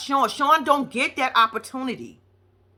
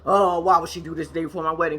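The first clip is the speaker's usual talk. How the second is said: mock stressed whine; 6 semitones lower than usual. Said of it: "stressed the fuck out".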